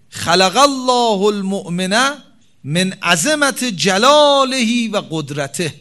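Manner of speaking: solemn chanted quote; hadith recitation. Acted. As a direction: intone the hadith slowly and with weight.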